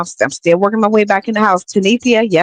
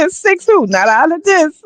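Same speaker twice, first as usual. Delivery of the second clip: high laughing squeal; laughing disbelief; 11 semitones higher than usual. Real, not acted. Laughing disbelief at six kids.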